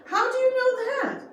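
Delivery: high startled shout, almost yelling; near yell. A narrator's reading. Dan, hearing of the landing plot, almost yells in alarm. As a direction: burst out in shock, voice jumping up. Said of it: "almost yelled".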